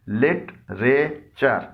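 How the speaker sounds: लिटरेचर stretched slowly into three syllables. Shown as a demonstration. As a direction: pull लिटरेचर apart into slow beats. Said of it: "इसके तीन हिस्से हैं".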